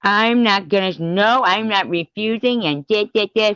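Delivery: high whiny parody voice; mocking quote. Parodying the masked rival's excuses, high and whiny.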